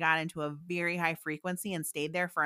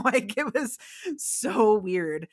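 slow, drawn-out marvel at the strangeness; weirded out; 3 semitones higher, 5 dB louder, much slower than usual. Real, not acted. Telling the host about the neon realm, slow and amazed. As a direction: stretch it out like you still can't believe it. Recalling a bizarre psychedelic realm.

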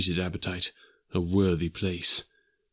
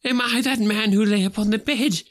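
higher, louder horrified cry; cried out; 13 semitones higher, 4 dB louder than usual. Acted. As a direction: cry out the dreadful question. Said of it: "he cried".

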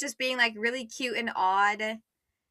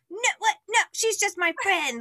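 higher-pitched mock protest; flustered denial; 8 semitones higher than usual. Harry to Dudley about Luna, flustered denial. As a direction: deny it too quickly, voice jumping up.

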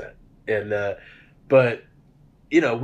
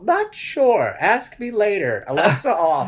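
higher, louder chirpy assistant voice; mock Alexa voice; 9 semitones higher, 4 dB louder than usual. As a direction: play a curt smart speaker brushing him off. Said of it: "And Alexa's just all".

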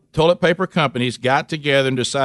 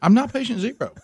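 pitched up, whining I'm not patient zero; whiny high denial; 10 semitones higher than usual. Shown as a demonstration. Husband mocking himself to wife, whiny high protest. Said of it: "I'm having to now say to my own wife".

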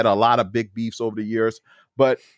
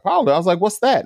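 high-pitched retold disbelief; puzzled surprise; 7 semitones higher, much faster than usual. Newcomer asks gym guy about powerlifting, puzzled. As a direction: query it high, as if never heard of it. Retells hearing of powerlifting first time.

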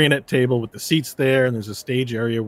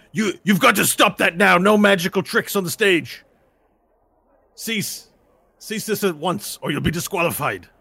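stern official; 7 semitones higher than usual. Acted. Official warns the fighter to stop the magic, mildly stern. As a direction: lay down the law to the rule-breaker. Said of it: "gruff-looking human comes up".

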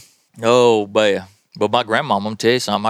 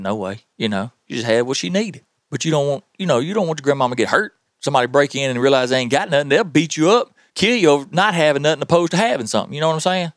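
ordinary delivery

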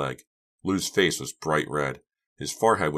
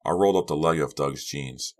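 ordinary delivery

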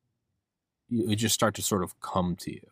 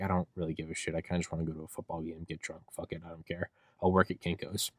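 apathetic giving up; 2 semitones lower, 8 dB quieter, faster than usual. Acted. Student who'd rather get drunk, apathetic. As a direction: toss it off quietly, past caring. Student voicing lost motivation.

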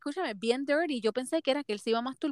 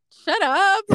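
"Shut up" más alto y agudo, en broma; suéltalo como una queja exagerada; exclamación imitada; 8 semitones higher, 5 dB louder than usual. Retold or imitated. Reacción imitada al marido. (exclamación burlona y más alta)

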